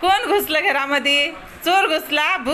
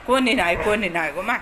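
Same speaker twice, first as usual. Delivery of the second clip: soothing; 6 semitones lower, 4 dB quieter than usual. Real, not acted. Owner reassures her dog that nobody came in, lower and soft. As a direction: lower and softer, gentle reassurance. Calming dog after bad dream.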